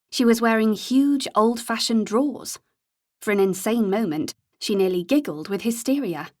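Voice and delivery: ordinary delivery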